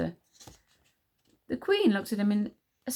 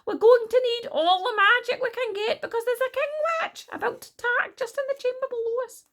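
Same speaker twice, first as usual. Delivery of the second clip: put-on high, loud, urgent girl's plea; crying out; 6 dB louder than usual. A narrator's reading. Wish warning everyone of the king witch, crying out. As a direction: plead urgently, pitched right up. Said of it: "cried Wish".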